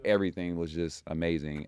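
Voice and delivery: ordinary talk, nothing marked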